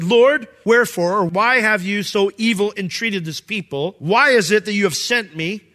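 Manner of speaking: loud, high complaint; anguished cry to God. Acted. Moses crying out to the Lord, loud anguished complaint.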